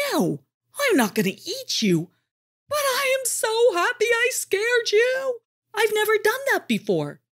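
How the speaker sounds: high, loud, giddy tiger voice; a delighted young tiger gushing; the wimpy tiger bursting with joy; excited tiger. A narrator's reading.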